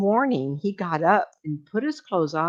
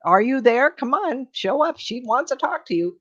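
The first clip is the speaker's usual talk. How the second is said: calling out to spirit; 6 semitones higher, 3 dB louder than usual. Retold or imitated.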